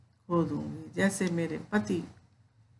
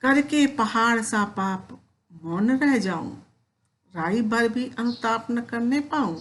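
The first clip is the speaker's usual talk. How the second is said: poem recitation; 3 semitones higher than usual. Acted. Teacher reciting Kaikeyi's plea to Ram, measured poem recitation. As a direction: recite the verse with feeling, slightly raised.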